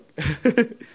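short high giggle; amused laugh. Real, not acted. Speaker plugging Facebook to audience, short high laugh.

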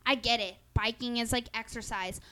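ordinary talk, nothing marked

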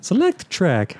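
high game-menu mascot voice; high cartoon game voice; Mario. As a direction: imitate the plumber's menu prompt. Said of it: "The voice of Mario".

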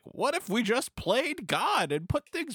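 high, slow, grandiose rich guy; Bruce Wayne voice. Retold or imitated. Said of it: "Bruce Wayne is like".

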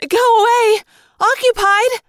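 loud, high-pitched urgent shout. Acted. Girl to someone opening the bathroom door, shouting. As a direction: shout them away from the door.

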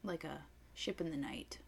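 very soft whisper, fading away; mock-mysterious whisper. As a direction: whisper it like slipping off unseen. Comic image of slipping away.

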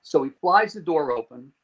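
ordinary talk, nothing marked

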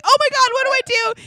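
high, loud, fast panicked cry. Retold or imitated. Wife panicking while her husband gags over dog puke, high fast cry. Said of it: "I'm like".

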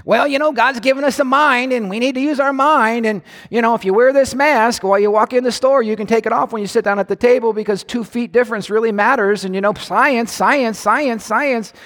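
high-pitched, rambling parody of sensible advice; mocked reasonable counselor. Reasonable counselor justifying masks, mocking high prattle. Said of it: "they listen to reasonable counselors".

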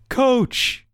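high-pitched mock cheer; mock call-out. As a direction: call it out high, as a retold catchphrase. Characters call out show title together.

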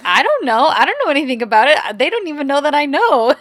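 a hint of panicked babbling; flustered friend. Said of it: "Pina's like".

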